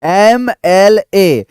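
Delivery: very slow, clear एम एल ए, each letter apart; spelled letter by letter. Shown as a demonstration. Teacher showing students the letters एम एल ए slowly. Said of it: "इसको कहते हैं एम".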